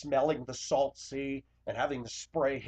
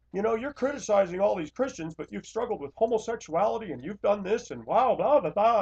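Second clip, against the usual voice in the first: higher, mock snide critic; mocking a smug viewer's attack; imagined critic; 8 semitones higher, 3 dB louder than usual. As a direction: parody a smug commenter. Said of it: "I can hear some wag getting on here".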